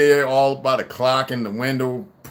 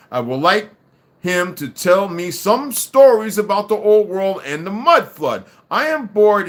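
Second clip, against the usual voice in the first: loud, higher-pitched indignant complaint; emphatic complaint; 6 semitones higher, 6 dB louder than usual. A narrator's reading. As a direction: build from level voice to loud, high grievance. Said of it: "she says under her breath".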